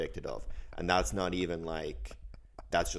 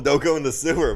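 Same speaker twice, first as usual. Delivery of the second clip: mock parent warning; 9 semitones higher, 9 dB louder than usual. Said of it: "I told him".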